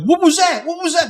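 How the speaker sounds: high, panicked mock cry; mock scream. Pizza kid runs out after explosion, high mock scream. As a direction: imitate someone running out yelling, pitched high. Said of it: "he runs out screaming".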